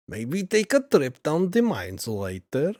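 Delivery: sing-song put-on Russian accent, pitch swooping; hammed-up Russian accent, imitating a text-to-speech voice; mock Russian accent. Streamer voicing the game line for chat, mock Russian accent. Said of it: "should just be you doing that".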